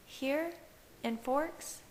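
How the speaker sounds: a touch of panic; frantic. A narrator's reading. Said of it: "she asked frantically".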